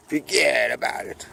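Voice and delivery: whispered Brooklyn "fuhgeddaboudit". Shown as a demonstration. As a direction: breathe the catchphrase in Brooklyn style. Jokey Brooklyn catchphrase whispered.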